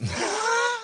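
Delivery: sudden high cackle at his own joke; burst of laughter. Real, not acted. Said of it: "Sorry, I'm making myself laugh".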